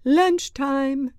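high, loud teacher's call; singled-out, projected announcement; announcing. A narrator's reading. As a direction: announce it to the whole room. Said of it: "she announced".

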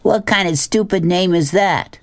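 high-pitched jeering question; mocking hoot. Billy ridicules the new girl's name, mocking hoot. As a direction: hoot it in scornful disbelief. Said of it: "hooted Billy".

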